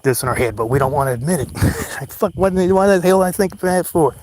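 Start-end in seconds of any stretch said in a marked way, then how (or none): 2.1-4.2 mock inner voice. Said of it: "And then it's like".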